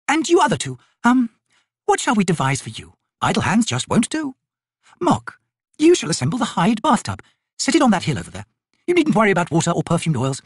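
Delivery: high, airy noblewoman giving orders; haughty lady, commanding. Acted. Lady assigning bath chores to her servants, commanding. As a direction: hand out chores grandly.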